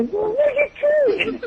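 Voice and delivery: very high-pitched incredulous squeal; hammed-up panicked protest; mock alarm. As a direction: exclaim it in exaggerated disbelief, voice leaping up. Retold protest to teammate mid-game.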